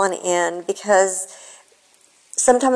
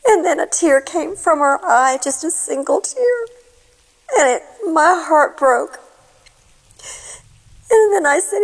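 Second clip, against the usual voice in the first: choked up; 6 semitones higher than usual. Real, not acted. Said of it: "with tears in my eyes".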